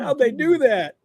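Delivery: high, loud mock-astonished question; mock amazement. Host imitating people freaking out over a screen trick, mock amazement. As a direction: play a baffled viewer marvelling at a trick. Mimics amazed viewers' reaction.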